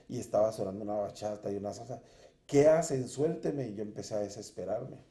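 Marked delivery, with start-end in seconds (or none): 2.5-3.7 loud, high frightened plea; retold cry for help. Terrified man to the shadows holding him, loud plea. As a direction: yell to break free of the shadows. Retells struggling with shadows at night.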